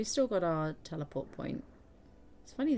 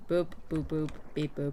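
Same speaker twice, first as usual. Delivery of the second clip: robot voice. Pretend. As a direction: beep boop robot voice. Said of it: "turning me into a robot".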